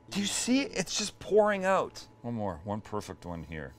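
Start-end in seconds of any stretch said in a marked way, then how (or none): 0.1-2.1 excited. Real, not acted. Juice pouring from the brisket.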